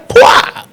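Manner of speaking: sharp breathy slap noise; slap sound. Retold or imitated. Planner imitating a slap she gave a guest, a sharp pwah. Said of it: "I just gave the girl".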